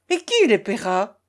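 mildly angry exclamation; indignant question from the mayor, lightly played; colère. A narrator's reading. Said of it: "s'écria le maire en colère". (colère contenue, question indignée)

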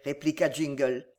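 plain reading voice

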